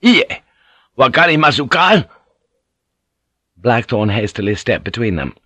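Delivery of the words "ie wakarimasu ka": high, loud angry snarl; snarled retort. A narrator's reading. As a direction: snarl back in defiance. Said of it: "Rodriguez snarled back".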